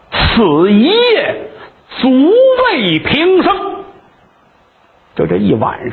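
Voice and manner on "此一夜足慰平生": very slow, words stretched with a catch in the throat; bow and savour each word; earnest vow, slow and choked; heartfelt farewell. Acted. Qin Zhong bows, heartfelt farewell.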